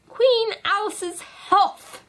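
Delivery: high, slow, screaming toast; screamed. A narrator's reading. Red Queen screams a toast to Alice. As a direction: scream it at the top of her voice. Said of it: "she screamed at the top of her voice".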